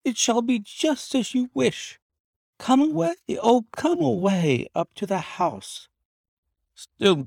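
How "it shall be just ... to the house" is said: high-pitched, eager wife's voice; urging wife. A narrator's reading. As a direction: coax him away in a high wife's voice. Relieved wife urges husband away.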